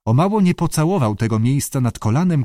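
usual speaking voice

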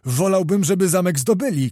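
high-pitched startled protest; cried out. A narrator's reading. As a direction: raise your voice in dismayed surprise. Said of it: "krzyknął Zagłoba".

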